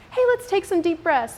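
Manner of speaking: high, bright coaxing call to calm down; lilting parental nudge; sing-song mom coaxing. Retold or imitated. Mom coaxing upset daughter.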